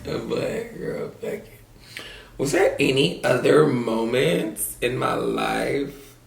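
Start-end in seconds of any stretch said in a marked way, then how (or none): 0.0-1.5 soft unvoiced throwaway; whispered aside. Real, not acted. Shrugging off story.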